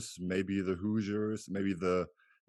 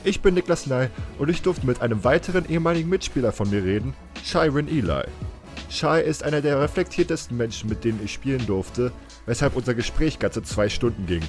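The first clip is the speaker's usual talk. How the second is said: louder, lively German host welcome; podcast intro; 4 semitones higher, 10 dB louder than usual. Acted. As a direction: read the show intro with projected, lifted energy. Host's scripted podcast intro in German.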